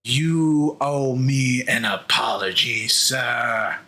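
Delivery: cold demand. Acted. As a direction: say it low and slow, wounded pride. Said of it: "he goes".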